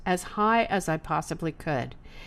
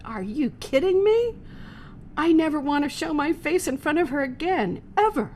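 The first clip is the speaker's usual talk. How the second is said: incredulous; 6 semitones higher than usual. Retold or imitated. Inner thought, mortified.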